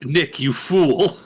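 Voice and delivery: slow gloating villain sneer; villain taunt. Acted. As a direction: taunt him like a cartoon villain.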